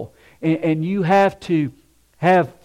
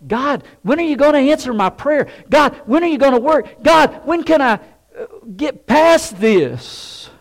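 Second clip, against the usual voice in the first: higher, pleading and impatient, slowing at the end; 1 exasperated prayer; 9 semitones higher, 5 dB louder than usual. Retold or imitated. Believer pleading with God for an answer, higher and exasperated. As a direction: cry out to God like a fed-up believer.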